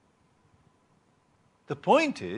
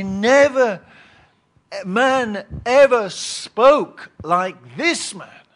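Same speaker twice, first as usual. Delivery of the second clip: loud, slow and higher, in awe; awed soldiers; 4 semitones higher, 5 dB louder, much slower than usual. Retold or imitated. Soldiers telling the chief priests, awestruck and loud. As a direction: let the wonder ring out. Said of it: "the, the soldiers say".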